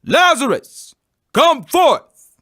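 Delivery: calling out loud and high; commanding loud call into the tomb; loud cry. A narrator's reading. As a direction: cry out to raise the dead. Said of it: "he cried with a loud voice".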